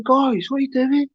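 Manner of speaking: retold bewildered protest. Acting out himself being beaten up.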